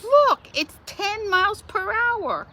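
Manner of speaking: put-on high, slow, insistent old lady; old mom. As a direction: spell it out like a stubborn granny. Old mom voiced to police.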